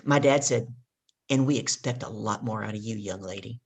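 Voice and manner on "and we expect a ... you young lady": a hint of a stern dad, lower and much softer; lightly voiced father's quiet reproach, lower. Dad scolding his daughter over her report card, low and soft.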